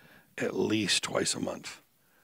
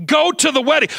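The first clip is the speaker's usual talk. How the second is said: loud, high preaching at full pitch; fiery preaching; 11 semitones higher, 9 dB louder than usual. Acted. Preacher pleading with the congregation at full force.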